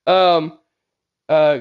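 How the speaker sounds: usual speaking voice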